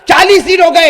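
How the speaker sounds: furious shouting king scolding his men; angry Pharaoh. Acted. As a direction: bellow at the soldiers in rage. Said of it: "اور کہا". (غصے میں چیختا فرعون)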